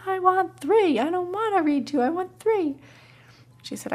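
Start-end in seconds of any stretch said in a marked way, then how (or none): 0.0-2.8 put-on child insisting. Toddler insisting on three books.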